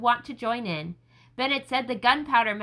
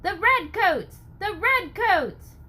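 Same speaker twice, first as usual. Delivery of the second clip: high, loud, drawn-out cry; warning shout; 7 semitones higher, 4 dB louder, slower than usual. Acted. Townsman warns of the redcoats, high loud shout. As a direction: yell the warning down the street.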